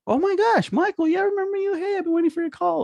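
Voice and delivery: very high, fast, thrilled patient voice; gushing excitement. Pretend. Patient to the caller, gushing with high excitement. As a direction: squeal like a fan who waited for the call.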